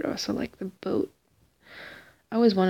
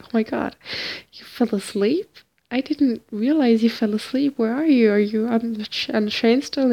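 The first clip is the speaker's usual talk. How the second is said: loud, high, frantic questions; panicked, almost shouting into phone; 3 semitones higher, 7 dB louder than usual. Retold or imitated. Panicked phone call, retold.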